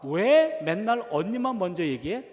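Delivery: a daughter griping that her sister always comes first; whiny child complaint. Retold or imitated. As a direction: drag out the complaint like a sulky kid. Daughter whining at her father.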